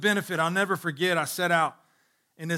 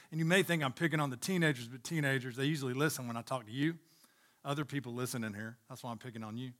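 teasing aside; 5 semitones lower, 8 dB quieter, faster than usual. Real, not acted. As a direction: drop the voice and tease them lightly.

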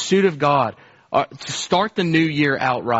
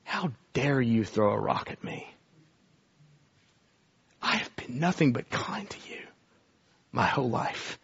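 soft, low, near-whispered rebuke; quiet, whispered divine reproach; hushed voice of God; 4 semitones lower, 9 dB quieter than usual. Acted. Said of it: "with this voice".

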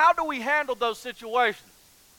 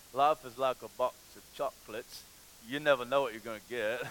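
Forrest impression; 12 semitones lower, 10 dB quieter than usual. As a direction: drop way down into a quiet character voice. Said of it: "as, uh, uh, Forrest has said".

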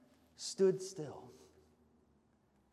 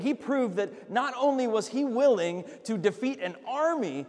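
louder, driving sermon climax; full-force preaching; 3 semitones higher, 5 dB louder than usual. Real, not acted. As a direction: build it up and preach it home.

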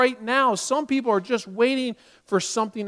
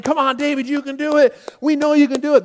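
high, loud rallying cheer; cheering him on; 6 semitones higher, 5 dB louder than usual. Retold or imitated. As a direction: egg him on like a supporter on the sidelines. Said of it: "cheering him on".

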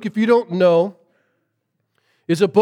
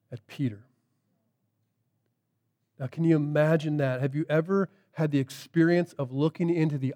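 soft, hushed sermon voice; quiet intimate preaching; 6 semitones lower, 6 dB quieter than usual. Acted. Preacher reflecting on Peter's failure, softly. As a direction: draw them in close and hushed.

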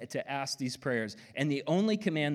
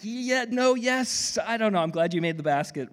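high, loud, fast flustered cheer; mixed cheering; 9 semitones higher, 6 dB louder than usual. Retold or imitated. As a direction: cheer then catch yourself, confused. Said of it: "it's like".

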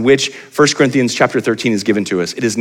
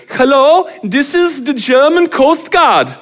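put-on much higher, slow, louder German accent on the radio; German coast guard accent; 4 dB louder, much slower than usual. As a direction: announce it slowly in a mock German accent. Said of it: "this guy gets on the mic and he goes".

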